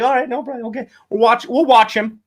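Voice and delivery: lightly voiced friendly party guest; obliging partygoer.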